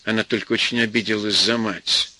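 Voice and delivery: ordinary delivery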